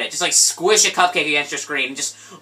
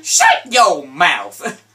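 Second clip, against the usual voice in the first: mock stern; 4 dB louder, much slower than usual. Mock telling off.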